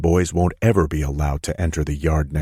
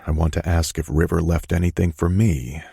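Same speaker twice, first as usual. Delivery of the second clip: ordinary delivery, nothing marked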